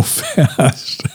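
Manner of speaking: laughing. Real, not acted. Laughs at own fast talking.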